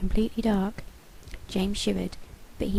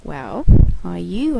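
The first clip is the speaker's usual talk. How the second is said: slow, louder cat voice; a sly cat asking, slowly; talking cat questioning the children; 2 semitones lower, 10 dB louder, slower than usual. A narrator's reading. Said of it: "asked Kiwi".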